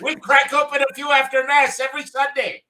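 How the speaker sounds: high, loud jolly priest; Father Pat. Acted. Priest Father Pat thanking the Guinness men, high and loud. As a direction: beam like a priest praising the beer.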